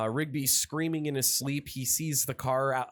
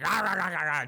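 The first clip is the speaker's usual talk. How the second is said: repeated mock roars, pitched up; imitated monster chomping; 4 semitones higher, faster than usual. Cab chasing and eating guys, cartoonish roaring. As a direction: go rah-rah like a ravenous car. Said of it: "and just, like".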